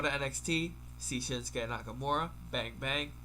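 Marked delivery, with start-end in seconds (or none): none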